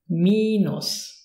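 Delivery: Minus as "MEE-noos", first vowel held; long I. Shown as a demonstration. Teacher repeating long I for learners, Minus with I held. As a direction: stretch the I of Minus as EE. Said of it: "Long version".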